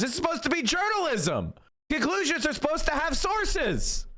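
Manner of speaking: high-pitched, exasperated rant; incredulous, worked-up protest; indignant outburst. Real, not acted. Angrily rebutting news clip.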